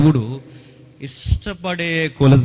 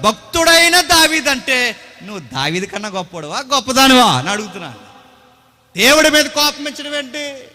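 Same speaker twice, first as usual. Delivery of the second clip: loud, higher, rebuking preacher; fiery preaching; 6 semitones higher, 7 dB louder than usual. Acted. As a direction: thunder at the congregation. Preaches at full force.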